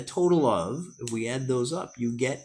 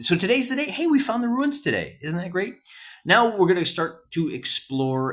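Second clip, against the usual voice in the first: high, bright excitement at the find; thrilled the ruins turned up; pitched up with glee over the ruins; excited; 8 semitones higher, 4 dB louder than usual. Real, not acted. Excited about finding the ruins.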